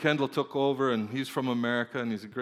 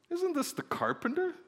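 much higher-pitched sceptical question; incredulous question. Acted. Hometown crowd questioning the carpenter's son, incredulous. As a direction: pitch it way up in disbelief.

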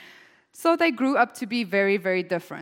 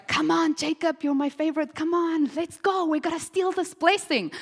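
higher, eager coaxing mother; scheming mom; 7 semitones higher than usual. Retold or imitated. Mother urging her son Jacob to steal the blessing, coaxing high voice. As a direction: coax your favourite son into the plan. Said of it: "Rebecca's like".

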